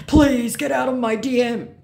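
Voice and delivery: loud, high mock outburst; exasperated scammer, voice pushed up. Scammer ordering the fan out of messages, mock exasperation.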